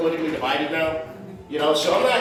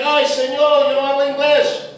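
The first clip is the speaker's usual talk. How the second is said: higher-pitched mock protest in Spanish; Spanish-speaking driver; 6 semitones higher, 3 dB louder than usual. Rear-ending driver to angry comic, mock protest. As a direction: act out the driver pleading no English. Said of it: "He's like".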